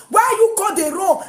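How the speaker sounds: "Why you go dey wrong" shouted high, "you" stressed; shouted accusation. Retold or imitated. Repeated line shouted.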